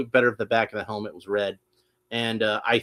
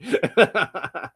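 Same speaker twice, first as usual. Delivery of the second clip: cackling skull; 7 semitones higher than usual. Acted. Cackle after Skeletor impression.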